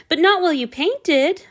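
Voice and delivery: lightly voiced boy pushing back; insistent Hanyu. A narrator's reading. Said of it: "Hanyu insisted".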